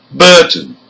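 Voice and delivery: "Burton" stressed on BUR, second syllable weak; first-syllable stress. Shown as a demonstration. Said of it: "the first syllable".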